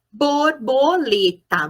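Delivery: slow, "Borboleta" broken into its syllables. Shown as a demonstration. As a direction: segment "Borboleta" beat by beat. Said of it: "um exemplo de silábica alfabética".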